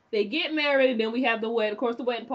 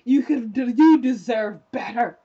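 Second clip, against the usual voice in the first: louder, urging a friend to move on; loud cheering on; 6 dB louder than usual. Retold or imitated. Fan shouting advice at Bash, louder. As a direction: tell him loudly he deserves better. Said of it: "And you just wanna be like".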